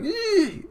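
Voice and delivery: very high mock shriek; cartoon fright squeak; startled squeal. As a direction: squeal "eee" as if scared of her.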